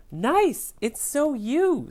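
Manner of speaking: high, eager gushing; lightly voiced gushing crayon; bright gushing compliment. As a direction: gush over the scarf, pitched high. Crayon character gushes compliment.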